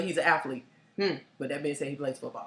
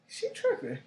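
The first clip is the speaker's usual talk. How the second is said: high, softer imitation of him; mimicked date's grumble; 7 dB quieter than usual. Date reacting to being told to move, high and soft. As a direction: quote him in a small, high voice. Retells his muttered reaction.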